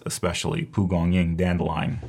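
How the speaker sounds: normal talking voice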